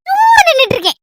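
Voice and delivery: high laughing shout of denial; guilty laughing outburst; laughing protest. Acted.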